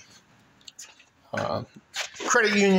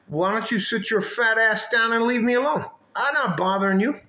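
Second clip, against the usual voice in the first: higher, animated brush-off; retold exasperated retort; 6 semitones higher than usual. Man asking her to leave him alone, retold exasperated retort. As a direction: act out a mildly heated telling-off.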